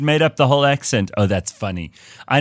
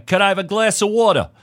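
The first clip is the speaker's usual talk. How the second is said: put-on American accent; 9 semitones higher than usual. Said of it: "make yourself understood".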